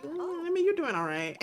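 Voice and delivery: high-pitched drawn 'mm', then settling; grudging approval that starts up high; hesitant concession. Acted. She answers his hope to be better, hesitant concession. Character's teasing, hesitant reply.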